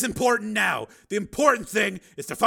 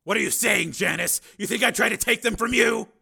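raised, hurt disbelief; indignant; 11 semitones higher, 12 dB louder than usual. Acted. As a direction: Steele stung by his ex-wife's accusation, voice raised and indignant. Steele accused of taking kids.

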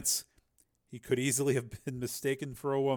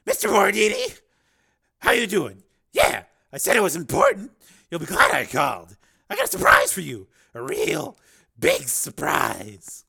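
loud, higher, wheedling pimp; pimp Rico, eager; 3 semitones higher, 9 dB louder than usual. Acted. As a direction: sell the surprise to a boss on the phone. Voices Rico on phone.

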